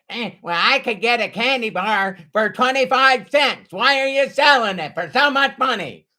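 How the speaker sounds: lightly voiced, mocking stingy neighbour; imitating a penny-pinching grump haggling with a kid; mocking cheapskate.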